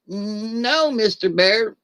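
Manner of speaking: a scared little rabbit answers the bear; timid rabbit. Acted. Joke's rabbit answers the grizzly, high and meek. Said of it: "little rabbit goes".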